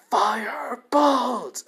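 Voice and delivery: long, high scream of the spell; slow, soaring attack yell; screamed battle cry. Acted. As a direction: scream it out, dragging each syllable. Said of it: "As he screams that out".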